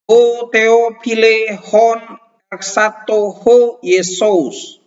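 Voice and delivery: slow, high, solemn reading of Greek text; slow Greek reading practice. Shown as a demonstration. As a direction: read aloud slowly, each word clear. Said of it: "Ya, latihan baca". (guru membaca teks Yunani dengan lambat dan khidmat)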